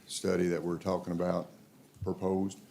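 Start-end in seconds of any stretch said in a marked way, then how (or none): none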